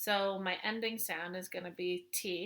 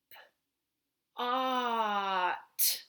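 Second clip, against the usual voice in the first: sounds dragged out slowly one by one; zombie talk; much slower than usual. Shown as a demonstration. Teacher stretching sounds for kindergarteners, dragged out. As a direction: drag each sound out like a zombie. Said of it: "I kinda zombie talk it a little bit, or I drag out the sounds".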